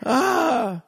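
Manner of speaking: high, exasperated mock outburst. Girlfriend fed up with slow driving, high exasperated cry. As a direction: squeal it out like an impatient passenger. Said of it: "She's like".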